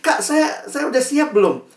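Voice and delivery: put-on high-pitched, hesitant young asker; confused teenager. Teenager asks mentor if they are ready, mock-unsure high voice.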